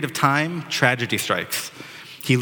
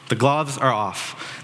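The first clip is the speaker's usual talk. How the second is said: slow emphatic repeat; much slower than usual. Retold or imitated. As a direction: stretch "The gloves are off." out slowly, each word weighted.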